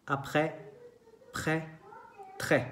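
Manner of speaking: "Après, près, très" ending on open E, silent S; open-mouthed è. Shown as a demonstration. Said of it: "entonces con la boca abierta". ("Après, près, très" con la e abierta)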